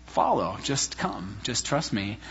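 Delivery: low, soft, gentle invitation; gentle call. Retold or imitated. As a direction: voice God calling softly. Voicing God's gentle call.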